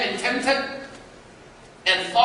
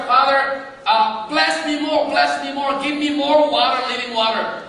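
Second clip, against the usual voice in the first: eager prayer; 6 semitones higher, 6 dB louder than usual. Retold or imitated. Voicing his own prayer.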